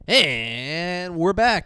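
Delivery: drawn-out, loud host announcement; slow booming we're back; slow announcer return. Acted. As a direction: welcome everyone back like a show host.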